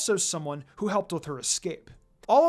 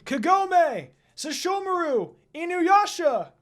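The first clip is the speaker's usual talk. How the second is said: much higher calls across a distance; calling out; 11 semitones higher, 4 dB louder, slower than usual. A narrator's reading. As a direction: call their names from afar. Friends calling out while running.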